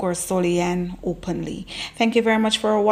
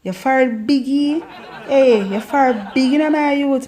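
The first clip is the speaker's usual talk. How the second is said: playful Jamaican patois, higher with laughter; Jamaican patois tease; 5 semitones higher than usual. Shown as a demonstration. Teasing a friend about a big forehead, laughing Jamaican patois. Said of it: "In Jamaica".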